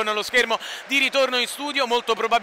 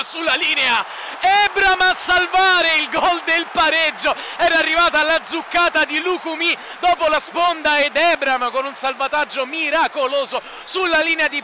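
excited shout; 4 semitones higher than usual. Real, not acted. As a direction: cry out at the clearance off the line. Goal-line clearance, live call.

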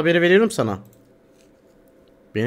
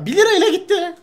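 high-pitched wail; 13 semitones higher than usual. Real, not acted. Lamenting one-lira loss.

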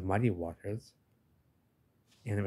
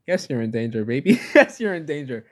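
higher, louder, laughing taunt; mock menacing shark; 5 semitones higher, 8 dB louder than usual. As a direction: gleefully threaten the little divers. Voicing the sharks to divers.

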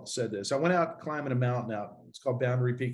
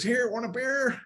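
put-on old man offering a beer; 12 semitones higher, slower than usual.

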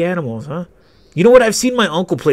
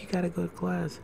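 very soft, raised girlish imitation; attempted cute voice; 4 semitones higher, 13 dB quieter than usual. Imitated classmate calling boy to class, quiet cute voice. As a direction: make it tiny and cute, barely audible. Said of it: "I can't make that cute voice".

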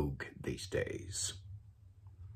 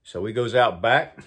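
ordinary talk

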